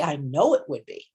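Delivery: firm, sure and settled; with certainty. Shown as a demonstration. A teacher modelling how to answer the inner voice with certainty. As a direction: state it as a plain fact. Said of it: "we practice speaking with certainty".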